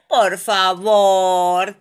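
ruego fuerte y muy alargado; súplica de todos. A narrator's reading. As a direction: suplica estirando cada sílaba. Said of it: "dicen todos". (rogando, muy lento y fuerte)

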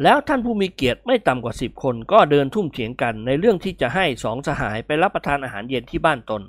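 ordinary delivery

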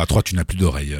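playful low hypnotist voice; mock hypnotist. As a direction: put her under like a stage hypnotist.

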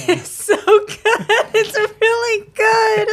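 squeaky high laughing praise; laughing high-pitched it's so good. Real, not acted. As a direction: gush through giggles. Friends mocking a recording, laughing.

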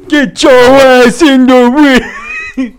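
put-on belligerent drunk. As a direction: slur a slow bar-fight challenge. Imitating Stone Cold Steve Austin.

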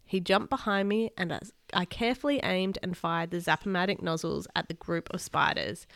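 ordinary delivery, nothing marked

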